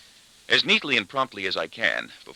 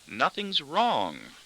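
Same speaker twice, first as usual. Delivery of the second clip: high, very slow, strained denial; gritted teeth; 7 semitones higher, much slower than usual. A narrator's reading. Daughter denying trouble to her father, gritted teeth. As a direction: stretch it out through gritted teeth. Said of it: "She grits her teeth".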